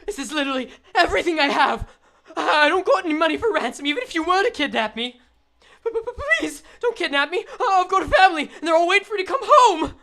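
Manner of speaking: high, loud, stammering victim; acted frightened wail; panicked pleading. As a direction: beg desperately for your life, hammed up. Voices pleading robbery victim.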